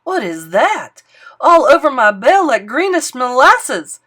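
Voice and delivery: loud, dismayed outcry; alarmed old man. Acted. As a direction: exclaim in disgust. Bell ringer sees goo on bell.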